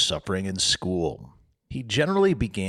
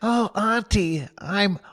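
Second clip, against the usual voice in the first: milk the pain, whining; moaning; 11 semitones higher, slower than usual. Acted. Tom faking illness to Sid, moaning.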